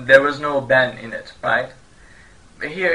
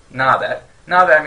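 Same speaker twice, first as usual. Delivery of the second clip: 1 lively what's up; 3 semitones higher, 8 dB louder, faster than usual. Shown as a demonstration. Casual greeting, second louder.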